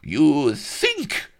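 put-on high, loud Spanish-accented outburst; indignant roar. A narrator's reading. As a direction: roar it in outrage. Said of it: "he roared indignantly".

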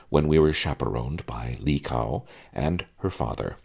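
ordinary delivery, nothing marked

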